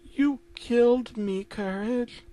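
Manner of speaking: slow, high ghostly accusation; Muriel accusing. Acted. Dead Muriel in the mirror to her dog, ghostly accusing.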